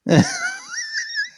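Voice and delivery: real high squealing laugh. Real, not acted. Listener laughing at an Aussie bloke impression, squealing laugh. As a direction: burst out laughing in delight.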